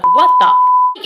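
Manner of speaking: shrieked sky-high and loud, stretched out; shocked outburst. Real, not acted. Vlogger shocked by the torture, high stretched shriek. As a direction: screech in disbelief.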